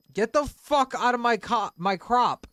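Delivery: high, loud angry shout; yelling. Real, not acted. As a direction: bark them out of your crop. Yelling at teammate in garden.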